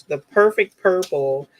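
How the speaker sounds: ordinary delivery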